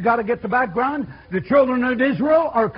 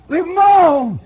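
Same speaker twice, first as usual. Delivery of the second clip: higher, louder "Leave 'em alone"; preaching shout; 7 semitones higher, 8 dB louder than usual. Real, not acted. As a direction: hammer it home to the congregation. Preacher repeats the charge, building.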